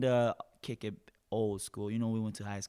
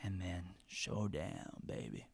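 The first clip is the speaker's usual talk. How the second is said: deep showdown voice; 5 semitones lower, 9 dB quieter, slower than usual. Acted. Dramatic announcement.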